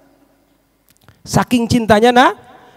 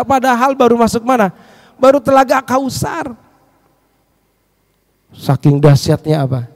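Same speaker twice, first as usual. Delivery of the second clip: normal talking voice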